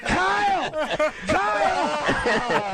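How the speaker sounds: high laughing shout of the name; laughing call. Real, not acted. Host laughing and calling out to a colleague, laughing shout.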